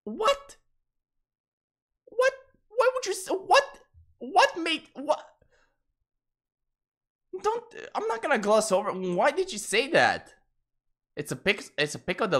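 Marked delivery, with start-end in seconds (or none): 0.0-5.3 high, squeaky disbelieving whats; shocked squeak. Real, not acted. Streamer squeaking at chat's message, shocked. 7.4-10.3 indignant protest. Real, not acted. Streamer protesting at chat.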